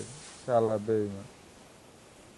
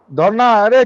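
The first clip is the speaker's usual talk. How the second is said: high, loud frantic yell; frantic shout; 7 semitones higher, 7 dB louder, slower than usual. Real, not acted. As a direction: shout at your teammate in panic. Teammate in danger, firefight.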